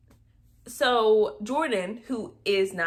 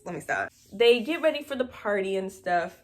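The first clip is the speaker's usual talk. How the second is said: ordinary talk, nothing marked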